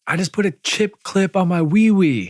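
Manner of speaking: put-on high, carrying kid's call; imitated kid's yell. Kid yelling a confession to his dad, mock high yell. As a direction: holler it like a boy from another room. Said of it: "yelling at his dad".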